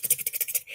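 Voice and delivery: whispered clock ticks; ticking sound. Shown as a demonstration. Describing his racing mind, whispered ticking sounds. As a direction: tick it out under your breath. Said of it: "it's like tick".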